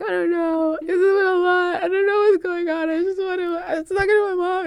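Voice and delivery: high, tearful retold crying. Telling the Caltrans worker she's overwhelmed, high tearful crying. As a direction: sob it out like you're overwhelmed. Retelling her breakdown to the worker.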